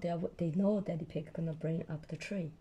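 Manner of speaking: usual speaking voice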